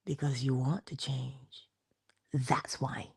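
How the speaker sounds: slow, low, soft answer; low and gentle, letting it sink in; hushed. Real, not acted. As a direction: say it quietly and deliberately. First, hushed take of answer.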